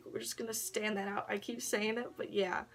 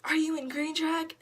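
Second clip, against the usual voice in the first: perky question; 8 semitones higher, 4 dB louder than usual. Retold or imitated. Girls asking her.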